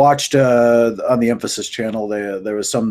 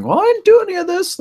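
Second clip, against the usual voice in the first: very high, louder imitation of hysterical protest; squeaky-high mock hysterics; 5 dB louder than usual. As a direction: mimic someone hysterically denying blame. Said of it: "every time she goes hysteric".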